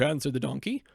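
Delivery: usual speaking voice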